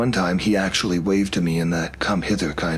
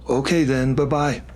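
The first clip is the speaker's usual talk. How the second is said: high, playful singsong bye; teasing goodbye; 7 semitones higher than usual. A narrator's reading. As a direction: tease him on the way out. Teasing exit.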